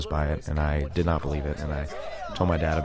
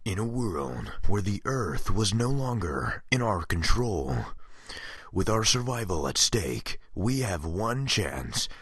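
movie trailer guy voice; 3 semitones higher than usual. Acted. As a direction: announce a blockbuster, slow and weighty. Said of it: "my movie trailer guy impression".

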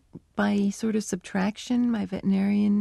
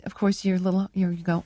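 normal talking voice

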